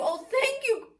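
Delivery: a high, heartfelt thank you; grateful cry. Acted. Witch thanking her friends for saving her, gratefully. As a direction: pour out your thanks. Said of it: "the grateful witch cried".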